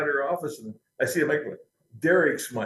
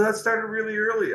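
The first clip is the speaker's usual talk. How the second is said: put-on much higher, British rocker accent on every word; English accent impression; 10 semitones higher than usual. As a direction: do the singer's English accent, then drop it. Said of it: "I don't do the accent anymore".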